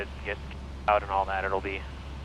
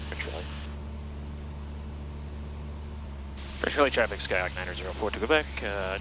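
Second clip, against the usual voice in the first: clipped radio call to traffic; pilot over the radio; 4 semitones lower, 3 dB quieter than usual. Real, not acted. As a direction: read it like a position report. Pilot radio calls in flight.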